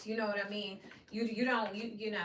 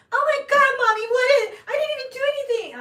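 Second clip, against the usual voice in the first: much higher, much louder whiny protest; imitating her daughter whining to mommy; high-pitched whiny kid; 16 dB louder than usual. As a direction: protest like a kid wrongly blamed. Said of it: "If you hear [name] start talking".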